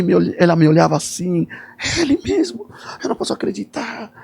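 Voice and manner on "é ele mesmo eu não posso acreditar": high, breathless wonder trailing off softer; lightly voiced stunned woman; amazed disbelief. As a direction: marvel that it's really him. Woman repeats her disbelief.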